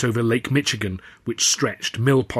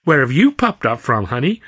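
playful flirty showgirl with a lilt; twinkling smile; 3 semitones higher, 4 dB louder than usual.

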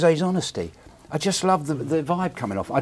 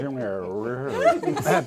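ordinary talk

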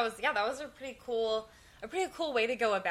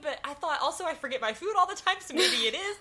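higher, animated disbelief; excited retelling; 8 semitones higher than usual. Retold or imitated. Dave explaining the container mix-up, excited. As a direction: gush about the mix-up. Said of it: "He's like".

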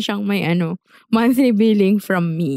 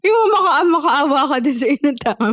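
laughing; 4 semitones higher than usual. Real, not acted. Teasing friend over milk tea.

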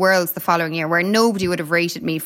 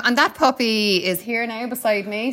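ordinary delivery

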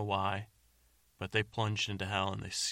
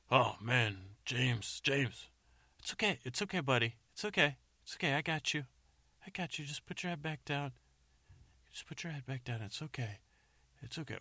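higher, gentle soothing voice; soothing a scared dog; 5 semitones higher than usual. Acted. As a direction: coax him calm like a frightened animal. Friend comforts injured man.

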